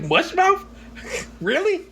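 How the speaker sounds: high, disbelieving squeak; can't believe what he heard; incredulous. Real, not acted. Host reacting in disbelief to a podcast's word choice, squeaky. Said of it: "incredulous at a podcast's word".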